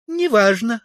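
very high female character voice; Тулия. A narrator's reading. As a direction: declare it in a high-pitched woman's voice. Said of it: "ответила Тулия из-за двери". (заявляет высоким женским голосом)

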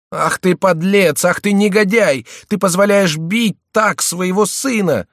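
high, loud, drawn-out scolding woman; furious cry. A narrator's reading. Mother attacking her husband for letting her son be beaten, furious. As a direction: shriek abuse at him, very high and slow. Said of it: "вскричала Лордиль".

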